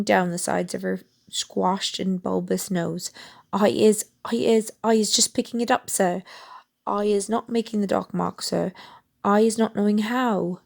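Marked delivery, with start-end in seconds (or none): none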